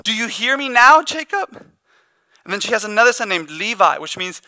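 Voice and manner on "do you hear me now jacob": higher, louder plea to be noticed; mock-begging wife calling her husband; pleading cry. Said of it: "Almost like she's crying out and saying".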